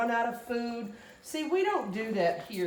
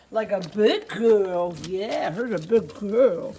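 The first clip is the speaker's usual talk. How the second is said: slow, drawn-out, louder puppy praise; 5 dB louder, slower than usual. Real, not acted. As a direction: stretch it out proudly for the puppy. Praising puppy in baby talk.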